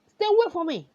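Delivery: cornered bully crying out in fright; scared yell. Acted. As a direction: back away yelling in fear. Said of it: "The boy is getting scared".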